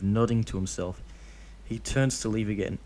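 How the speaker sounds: usual speaking voice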